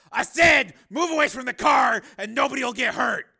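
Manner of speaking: high, loud, insistent command; shouted order. Acted. Steele repeating his demand to the pimp, shouted. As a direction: repeat the order at full force.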